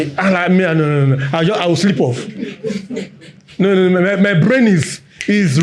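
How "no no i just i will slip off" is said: ordinary talk, nothing marked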